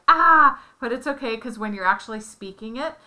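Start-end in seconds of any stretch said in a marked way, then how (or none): 0.1-0.6 a loud high yelp of panic; mock panic. Parent acts out a flustered Spanish speaker, loud and high. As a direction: blurt it out as if caught off guard. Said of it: "And so you're like".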